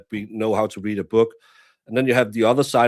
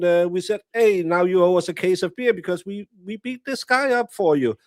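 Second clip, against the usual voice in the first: mock Fyn peasant accent; 4 semitones higher than usual. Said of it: "you'll use that accent because they are very peasantly".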